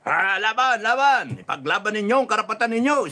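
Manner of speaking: rallying call. Pretend. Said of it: "isang sabi lang niya".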